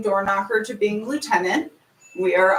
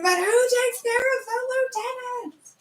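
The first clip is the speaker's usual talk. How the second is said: crying. Retold or imitated. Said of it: "was crying somewhere saying".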